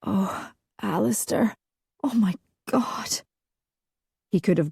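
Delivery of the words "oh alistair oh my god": soft, higher-pitched, overcome murmur; feverish murmur. A narrator's reading. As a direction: murmur it feverishly, in broken phrases. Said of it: "murmured feverishly".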